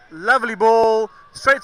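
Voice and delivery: excited commentary. Real, not acted. Live cricket commentary, a good delivery.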